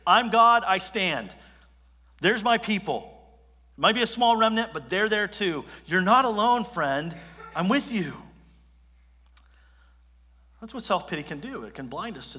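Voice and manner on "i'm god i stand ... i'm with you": God laying out the truth. Acted. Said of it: "laying out".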